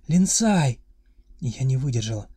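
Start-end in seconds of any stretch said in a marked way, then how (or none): 0.1-0.8 loud, high screaming of a name; frantic scream. A narrator's reading. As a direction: scream the name in wild relief. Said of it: "истошным воплем".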